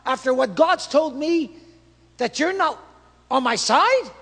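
high, loud, indignant disbelief; shocked protest. Acted. Joshua protesting to the commander of the Lord's army, shocked and loud. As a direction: protest like a betrayed servant of God.

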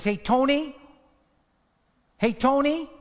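high, loud call across the room. Retold or imitated. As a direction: call out sharply to get his attention. Girl calls out to mafia man.

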